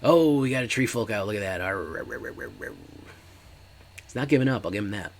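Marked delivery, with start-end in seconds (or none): 1.6-2.7 a string of playful mock werewolf snarls; werewolf growls. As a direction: snarl like a werewolf rushing the crowd. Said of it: "That's the sound of the werewolf".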